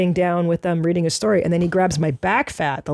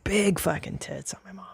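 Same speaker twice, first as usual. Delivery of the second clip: soft unvoiced whisper; 9 dB quieter than usual. Real, not acted. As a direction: hush it out with a smirk. Whispered echo of joke.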